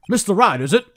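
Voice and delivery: hammed-up, booming address; Detective Gumshoe. CEO checking the lawyer's name, hammed-up Gumshoe-like voice. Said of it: "I'm doing a Detective Gumshoe voice".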